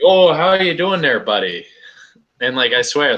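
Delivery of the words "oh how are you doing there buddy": high, loud mock local greeting, falling on "buddy"; hammed-up South Park-style local accent; imitated local small-town accent. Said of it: "the way they talk".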